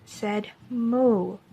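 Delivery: put-on gentle picture-book voice for young listeners; storybook reading. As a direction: read it like story time in class. Reads a picture book to children.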